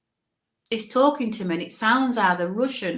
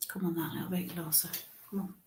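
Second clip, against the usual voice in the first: hushed aside; 2 semitones lower, 13 dB quieter, faster than usual. Real, not acted. Medium asking the spirit to come closer, hushed aside. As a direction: coax gently under the breath.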